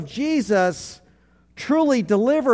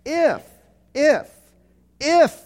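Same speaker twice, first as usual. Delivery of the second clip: if, if, if, each higher and louder than the last, building; stress on if; 7 semitones higher, 4 dB louder, slower than usual. Shown as a demonstration. Pastor drilling the congregation on if, building emphasis. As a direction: hammer the word if, climbing each time. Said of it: "Say the word if".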